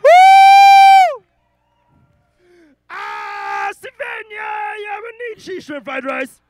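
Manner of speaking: high-pitched, full-volume crazy shouting; wild yelling. Real, not acted. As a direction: let loose and scream it at the crowd. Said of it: "before I yell more things".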